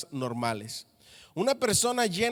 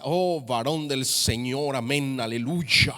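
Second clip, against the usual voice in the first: mock churchy voice; 3 semitones lower, slower than usual. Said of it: "Cuando hablan en la iglesia".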